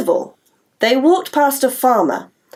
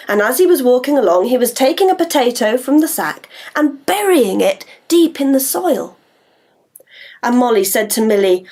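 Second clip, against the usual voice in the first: plain reading voice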